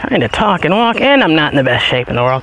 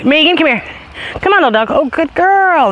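high call and praise; 10 semitones higher than usual. Real, not acted. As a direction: yell her over, then reward her. Calling and praising dog.

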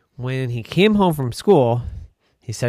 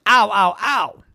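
pained cries; 6 semitones higher, 4 dB louder than usual. Acted. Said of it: "and he said".

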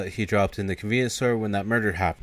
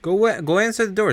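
higher, impatient order; waking the sleeping guy, annoyed; bossy yell; 10 semitones higher than usual. Retold or imitated. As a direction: bark him awake. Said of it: "be like".

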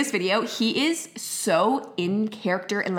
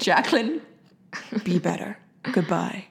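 mocking imitation; 2 semitones lower, 4 dB quieter than usual. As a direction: mimic her friend softly and scoldingly. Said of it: "you're saying".

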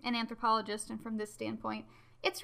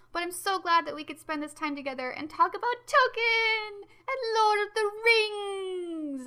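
bursting with excitement, voice cracking high at the end; so excited; 9 semitones higher, 5 dB louder than usual. Real, not acted. Host thanking listeners for the episode, overflowing with excitement. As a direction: gush about it, nearly tearful with joy. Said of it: "I'm so excited".

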